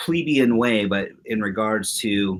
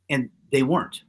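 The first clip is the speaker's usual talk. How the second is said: ordinary talk, nothing marked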